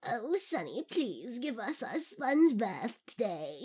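put-on wavering old lady, pitch swooping up and down; drawn-out, hammed-up elderly wobble. Old lady asking the nurse for a sponge bath, in old lady voice. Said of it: "it was supposed to be in old lady voice".

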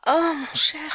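loud moaned oh, mon cher; voiced moan then French endearment; moaning cry. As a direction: cry it out in ecstasy. Lover cries out in pleasure.

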